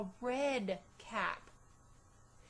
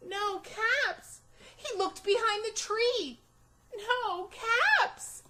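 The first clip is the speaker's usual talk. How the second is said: building alarm; 8 semitones higher, 6 dB louder than usual. A narrator's reading. Frantic search for caps.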